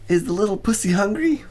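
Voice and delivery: high, sweet pet talk; baby-talk cooing. A narrator's reading. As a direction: coo at the cat. Cooing to the cat.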